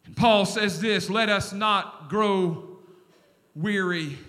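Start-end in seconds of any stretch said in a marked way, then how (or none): 1.1-4.2 slow, much lower, soft verse; solemn scripture reading. Acted. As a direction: lay the verse down gently.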